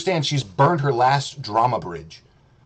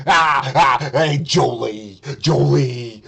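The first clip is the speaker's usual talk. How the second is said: mocking imitation; 3 dB louder, much slower than usual. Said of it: "This whole, like".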